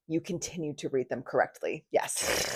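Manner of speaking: soft, tired deadpan; a mock weary witness, trailing to a breath; weary deadpan Johnny. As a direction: say it quietly like you've been asked five times. Johnny quoted, weary reply.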